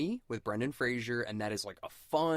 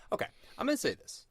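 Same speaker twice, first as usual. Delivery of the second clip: much higher and fast, bursting to get it out; eager announcement; 13 semitones higher, faster than usual. Real, not acted. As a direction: blurt it out like a big reveal. Announcing a confession, repeated.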